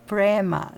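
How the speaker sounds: プレーマー with a punched long "ay": PRAY-maa; American pronunciation. Shown as a demonstration. Fan adopts the singer's version from now on, American pronunciation. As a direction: say プレーマー American-style, leaning on "ay". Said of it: "アメリカンな発音でね".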